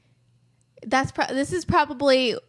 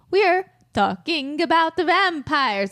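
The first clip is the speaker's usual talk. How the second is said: raised, mock Italian vampire voice; Italian vampire accent; 5 semitones higher than usual. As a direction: try a vampire line with an Italian flair. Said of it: "So that's an Italian vampire".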